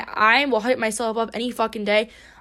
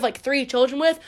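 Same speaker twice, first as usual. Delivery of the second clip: ordinary delivery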